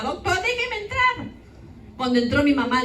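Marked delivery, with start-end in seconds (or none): 0.0-1.3 high, slow pleading through a door; mother pounding on the door, begging the doctor, high and slow; desperate mother begging. Retold or imitated. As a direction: beg like a weeping mother shut outside. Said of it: "Lloraba mi mamá, tiraba la puerta".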